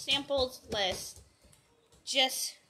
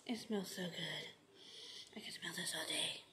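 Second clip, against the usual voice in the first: soft, dreamy near-whisper; savoring a smell; 6 semitones lower, 13 dB quieter, faster than usual. Real, not acted. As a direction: breathe it in, enchanted. Sniffing the scent, softly.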